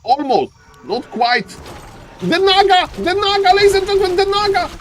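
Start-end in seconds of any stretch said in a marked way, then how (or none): 2.2-4.7 much higher, shouted hype call; ecstatic shout. Real, not acted. Caster shouting to ladies and gentlemen about the Naga, ecstatic. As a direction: roar it to the crowd.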